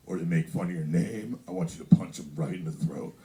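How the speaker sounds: low-pitched dad giving orders; mock fatherly advice, pitched low; crazy dad. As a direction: imitate your dad's low voice. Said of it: "That's how he talks".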